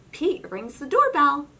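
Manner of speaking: ordinary delivery, nothing marked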